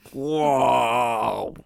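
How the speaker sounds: long drawn-out "waouh"; awed wow. Retold or imitated. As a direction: let out one long, amazed wow. Wordless exclamation of awe at the memory.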